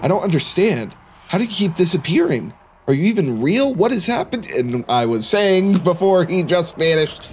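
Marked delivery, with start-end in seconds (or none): 0.0-4.5 higher-pitched, bewildered pleading; acted dismay, rising toward the last question; distressed questioning. Narrator confronting the figure who keeps disappearing, distressed.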